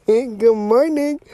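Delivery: bright, laughing good morning; laughing greeting. Real, not acted. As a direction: laugh it out warmly.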